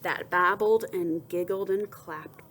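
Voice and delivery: usual speaking voice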